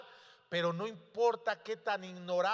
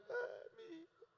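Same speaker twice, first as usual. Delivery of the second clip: high, thin wail, much softer; grieving mother; 13 semitones higher, 14 dB quieter than usual. Acted. The widow weeping over her son, high and soft.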